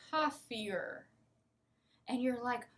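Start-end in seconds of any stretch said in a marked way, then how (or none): 0.1-1.1 slow "coffee-er" as one drawn-out word; merged OR. Shown as a demonstration. Teacher showing what learners hear, one merged word. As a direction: stretch coffee and "or" into "coffee-er". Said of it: "you hear coffeeer".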